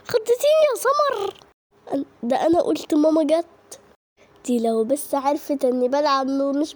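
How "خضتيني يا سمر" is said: laughing, voice up high; relieved giggle through the words; laughing relief. Acted. Girl laughs at friend Samar who startled her.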